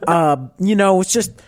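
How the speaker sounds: put-on Southern US accent. Shown as a demonstration. Podcaster doing requested impression for co-hosts, broad Southern accent. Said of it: "the Southern accent?".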